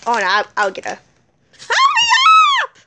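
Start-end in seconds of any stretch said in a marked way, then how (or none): 1.6-2.8 very high shouted demand; urgent scream. Pretend. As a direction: shriek for help to come faster. Hurt Frankie demands help.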